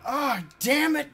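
high, loud, drawn-out cry; frustrated shout. Real, not acted. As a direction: shout it out in exasperation. Dying in game, yelling.

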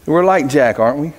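normal talking voice

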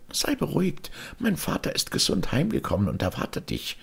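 very high, tiny bird voice; little sparrow voice. A narrator's reading. Young sparrow greeting the old man, very high and tiny. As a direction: chirp it like a small polite sparrow.

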